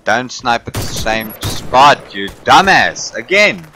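angry shout. Real, not acted. Yelling at enemy sniper.

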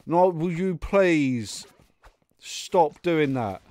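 slow, stretched-out begging; exasperated plea. Real, not acted. Player begging the dashing enemy to stop, slow exasperated plea. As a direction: drag out each word in weary frustration.

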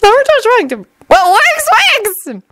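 very high, squeaky voice; high-pitched, excitable boy's voice; Naruto. A narrator's reading. Naruto answering Shino, high squeaky voice.

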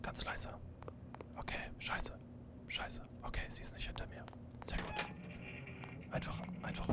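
very quiet, voiceless whisper. Pretend. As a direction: whisper to stay hidden from her. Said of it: "wenn ich flüster".

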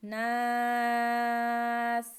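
নাস with the ন held long and nasal; nasal ghunnah. Shown as a demonstration. Teacher shows pupils the ghunnah on নাস, long and nasal. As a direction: hum the ন of নাস through the nose, drawn out slowly. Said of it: "গুণা করে পড়তে হবে".

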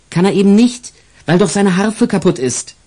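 put-on high indignant little girl; girl objecting. Isabel objects to the policeman, high and indignant. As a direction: protest like a child.

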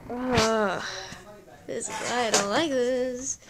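loud, drawn-out exasperated groan. Real, not acted. Reader interrupted mid-story, loudly exasperated.

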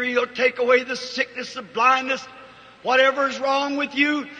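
ordinary talk

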